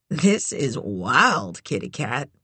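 deep, slow laid-back drawl; low slow man. Acted. Manny voiced low and slow.

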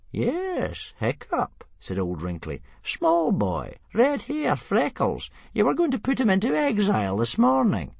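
put-on a knowing old man reminding the chief; Old Wrinkly reminding Stoic about his son.

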